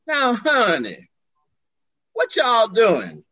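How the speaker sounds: slow, high Southern drawl; Southern friend catching two lovers, drawn out with Southern lilt; Southern accent. Retold or imitated. Southern accent, drawled and teasing. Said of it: "He had a Southern accent".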